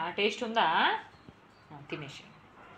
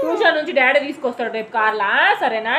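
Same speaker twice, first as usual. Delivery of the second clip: louder, higher coaxing voice; 10 semitones higher, 10 dB louder than usual. Real, not acted. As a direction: coax her out of tears, raising your voice over the crying. Mother soothing crying daughter.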